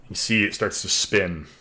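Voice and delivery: ordinary talk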